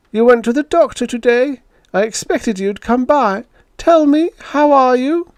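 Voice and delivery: pry sweetly through the door; busybody neighbour calling through a door; nosy neighbour. Acted. Nosy neighbour Mrs Schultz voiced.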